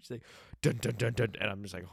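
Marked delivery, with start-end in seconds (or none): 0.6-1.3 loud, high dramatic sting; mock suspense music; dramatic dun dun. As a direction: belt out the doom chord. Dramatic sound effect imitation.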